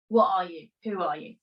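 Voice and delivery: ordinary delivery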